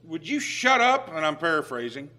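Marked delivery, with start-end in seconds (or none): 0.1-1.1 hollering. Retold or imitated. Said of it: "he hollered over to the other".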